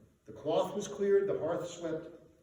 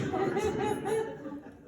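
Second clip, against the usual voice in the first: gleeful laugh. A narrator's reading. Guests laugh at the answer.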